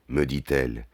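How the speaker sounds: usual speaking voice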